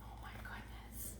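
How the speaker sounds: hushed amazed whisper. Real, not acted. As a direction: whisper it in awe.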